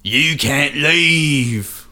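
very slow, drawn-out ghostly warning; spooky ghost voice. Acted. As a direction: moan it like a trapped spirit. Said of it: "Would you rather".

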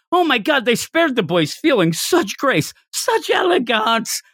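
high, swooning praise, slowing on the last words; gushing rapture. Retold or imitated. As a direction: swoon over their grace like a fanboy. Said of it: "he's just going nuts".

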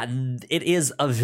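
usual speaking voice

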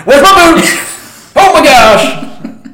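high, slow, panicked wail; hammed-up terror over frozen feet; mock freaking out. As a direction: Pete whining about his frostbitten feet, mock panic. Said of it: "Dude starts just freaking out".

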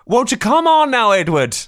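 high, loud mock Irish mother scolding; Irish accent. Co-host as Edward's childhood mum calling him, Irish accent. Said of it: "whenever I do that accent".

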